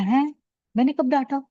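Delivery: high, disbelieving double question; retold shock. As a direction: re-enact a startled protest at being accused. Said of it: "I was so shocked".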